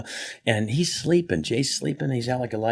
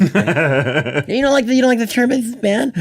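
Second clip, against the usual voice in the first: high loud teasing Leno; imitating Jay Leno teasing; Jay Leno impression; 12 semitones higher, 5 dB louder than usual. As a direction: needle him like Jay. Jay teasing on bumpy flight.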